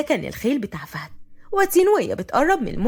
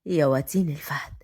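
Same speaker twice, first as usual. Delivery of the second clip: low, soft intimate whisper; 10 semitones lower, 5 dB quieter than usual. A narrator's reading. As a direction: whisper her name like a claim. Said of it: "وقال بهمس". (بهمس)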